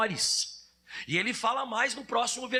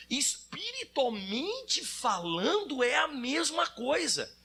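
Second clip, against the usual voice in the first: ordinary delivery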